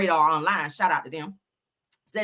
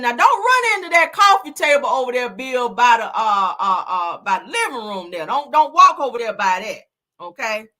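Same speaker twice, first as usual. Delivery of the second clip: mock phone call; 4 semitones higher, 7 dB louder than usual. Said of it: "calling him on the phone saying".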